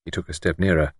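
plain reading voice

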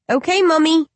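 put-on high, innocent little child asking; a small uncanny baby calling its mother. Undead baby asks its grieving mother, high and childlike.

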